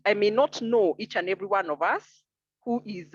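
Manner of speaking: ordinary talk, nothing marked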